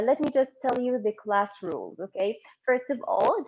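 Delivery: ordinary talk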